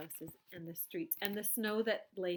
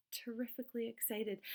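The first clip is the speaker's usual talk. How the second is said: ordinary delivery, nothing marked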